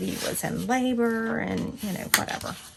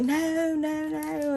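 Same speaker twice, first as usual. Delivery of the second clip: alarmed nurse; 10 semitones higher, much slower than usual. Retold or imitated. Nurse protesting.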